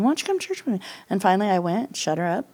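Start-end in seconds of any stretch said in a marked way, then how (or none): 0.0-0.8 higher, insistent repeat of the plea; mock mother-in-law asking yet again; repeated nagging. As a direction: nag once more, wearily. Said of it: "she's like".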